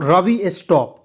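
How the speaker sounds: loud shouted command to stop Ravi; loud call of Ravi stop. Shown as a demonstration. Teacher calls out example command.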